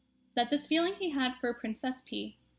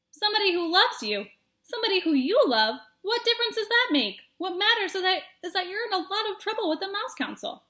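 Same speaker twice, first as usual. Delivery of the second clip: higher, loud disbelief; incredulous scoffing; 8 semitones higher, 5 dB louder than usual. Acted. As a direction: scoff at the idea. Furlow incredulous.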